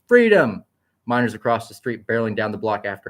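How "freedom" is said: loud, high ringing scream. A narrator's reading. Young woman screams over the sirens, loud and high. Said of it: "as the young woman screamed".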